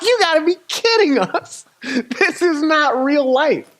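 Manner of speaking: high, loud disbelief; can't-believe-this retold protest; incredulous outburst. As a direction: exclaim it in drawn-out, exasperated disbelief. Acted outburst of disbelief at setback.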